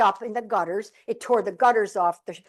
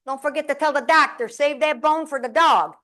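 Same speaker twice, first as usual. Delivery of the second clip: a raised, louder quip from dad; joking father; 5 semitones higher, 4 dB louder than usual. Retold or imitated. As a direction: call out the joke to Mom as she's wheeled away. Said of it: "my father goes".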